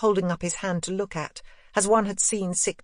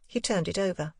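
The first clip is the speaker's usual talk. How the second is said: usual speaking voice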